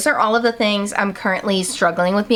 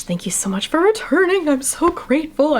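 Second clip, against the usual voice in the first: breathy voice; 6 semitones higher than usual. Real, not acted. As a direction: breathe the gratitude out gently. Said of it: "I don't know why I'm using this breathy voice".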